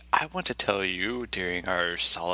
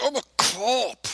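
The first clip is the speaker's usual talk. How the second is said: high, slow put-on cop; mocking the officer's voice; silly cop voice; 4 dB louder than usual. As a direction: drag out the cop's question in a goofy voice.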